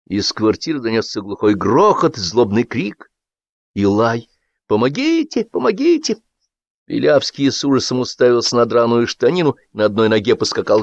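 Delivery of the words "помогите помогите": high panicked cries; cry for help. Acted. Man bitten by the dog crying for help, high.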